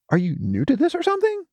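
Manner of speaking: high, fast scornful jab; mocking question. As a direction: fire it off in disbelief. Smudge exasperated, mocking.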